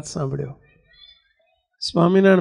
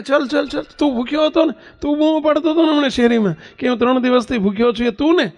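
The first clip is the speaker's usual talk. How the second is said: eager questioning; 8 semitones higher than usual. Acted. Maharaj eagerly questions the boy.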